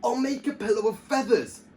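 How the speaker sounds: high, bright eager voice; excited. A narrator's reading. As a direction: announce it with delight. Knight's happy idea.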